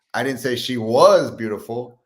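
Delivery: was punched high and loud; stress on was. Shown as a demonstration. Coach shows another version, stressing was. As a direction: lean hard on was, as if correcting. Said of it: "you emphasize each word".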